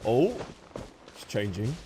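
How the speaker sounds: ordinary talk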